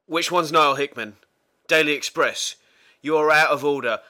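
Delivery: half-shouting the rant back; mock angry manager. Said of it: "the transcript goes something like".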